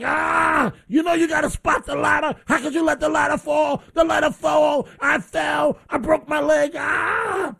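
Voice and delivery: high pained yelling at his son; retold yelling. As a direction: shout it up high as if in pain from the fall. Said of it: "And I'm like".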